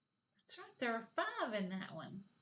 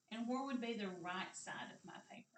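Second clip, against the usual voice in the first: quick whispered question; hushed teacher question; faster than usual. Shown as a demonstration. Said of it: "whispered question to class".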